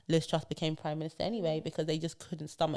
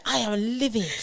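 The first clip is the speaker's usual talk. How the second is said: higher, prim posh voice; clipped posh outrage; posh understated fury; 5 semitones higher, 4 dB louder than usual. Retold or imitated. Posh British person doing anger, higher and prim. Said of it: "a posh British person can".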